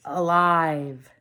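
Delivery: ordinary delivery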